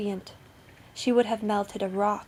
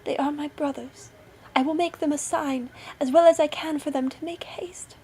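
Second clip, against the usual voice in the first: high, eager voice, getting louder; joyful wife; 6 semitones higher, 4 dB louder than usual. A narrator's reading. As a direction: rejoice that rescue is near. Wife's joyful voice, rescue near.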